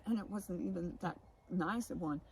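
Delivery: normal talking voice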